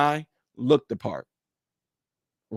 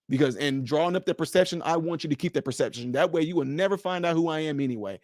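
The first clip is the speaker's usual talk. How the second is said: normal talking voice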